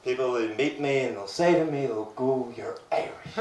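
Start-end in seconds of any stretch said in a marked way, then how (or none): 2.6-3.4 very soft, whispery mock amazement; hushed marvelling. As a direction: say it under your breath, as awed strangers. People meeting him marvel at accent.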